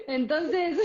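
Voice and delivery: laughing. Real, not acted. Speaker resumes after joking about the true film, laughing. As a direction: carry on talking through a laugh.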